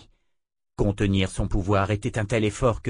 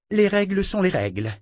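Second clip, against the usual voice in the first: voice breaking; 9 semitones higher than usual. A narrator's reading. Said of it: "Sa voix se brisa".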